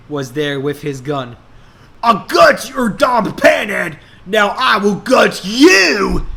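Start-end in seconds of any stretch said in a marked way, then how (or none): 2.0-6.2 higher, loud hammed-up villain shout; villain threat. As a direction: bark the threat like a cartoon baddie. Villain Bill Ray threatens.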